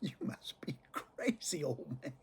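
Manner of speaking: hushed private thought at dad; inner thought whispered. Retold or imitated. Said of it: "I was thinking to myself".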